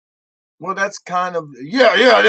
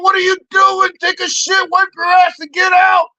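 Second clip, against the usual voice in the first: very high, loud scolding; retold exasperated yell; 10 semitones higher, 5 dB louder than usual. Comic retelling his orders to Santi, exasperated shout. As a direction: rattle out the orders in comic outrage.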